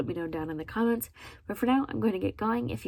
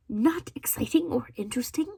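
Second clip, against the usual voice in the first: raised pitch, questioning disbelief; incredulous echo; 4 semitones higher than usual. Real, not acted. Quoting a reviewer's verdict back, incredulous. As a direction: throw the words back as a question.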